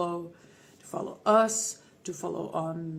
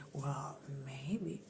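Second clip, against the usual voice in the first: teasing whisper; 6 semitones lower, 11 dB quieter than usual. Acted. Teasing answer.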